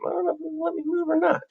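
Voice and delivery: soft, very high playful voice; high-pitched aside. Real, not acted. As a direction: say it small and high, as a side remark. Playful aside while image will not move.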